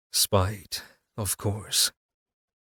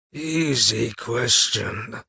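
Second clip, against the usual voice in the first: slow, slurred corpse voice; much slower than usual. A narrator's reading. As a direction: slur it out lazily. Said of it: "Cassian slurred".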